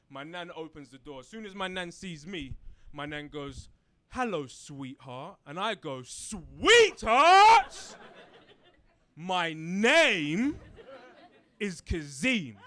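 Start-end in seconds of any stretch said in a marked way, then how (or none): 4.1-5.4 tender greeting. Retold or imitated. Nan at the door greeting grandson, slow tender hello. 6.0-12.6 outraged yell. Retold or imitated. Grandson rejecting nan's pet name, loud outraged yell.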